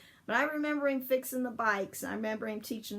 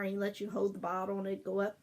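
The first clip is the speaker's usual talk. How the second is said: ordinary talk, nothing marked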